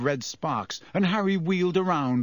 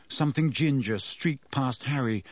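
usual speaking voice

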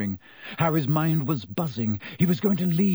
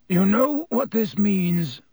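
slow, higher-pitched abrupt opening; abrupt question; 7 semitones higher, 4 dB louder, slower than usual. A narrator's reading. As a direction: break in suddenly, drawing the words out.

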